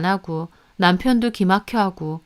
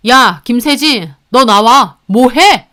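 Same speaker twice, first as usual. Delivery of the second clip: angry yelling; 2 semitones higher, 8 dB louder, slower than usual. Acted. Husband yelling for sister in room.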